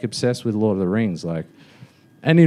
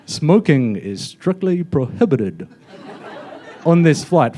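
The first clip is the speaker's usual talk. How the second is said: slow mock New Zealand announcement; New Zealand accent; 3 semitones higher than usual. Cabin crew warning passengers about smoking, mock New Zealand accent. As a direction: draw out broad New Zealand vowels. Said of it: "the New Zealand accent".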